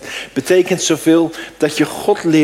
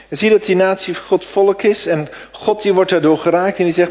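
ordinary talk

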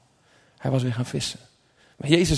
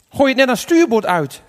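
raised, higher call; 7 semitones higher, 5 dB louder than usual. A narrator's reading. Jesus calls instructions to the fishermen, calling out. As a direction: call it out to the boat. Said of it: "riep Jezus".

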